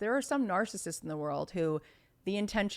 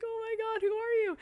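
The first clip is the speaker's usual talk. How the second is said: high, teary mock sob of awe; imitating sobbing. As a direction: squeak it out as if crying at a concert.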